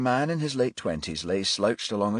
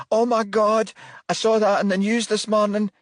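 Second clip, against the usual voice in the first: shocked; 7 dB louder than usual. Acted. Mother shocked by murder.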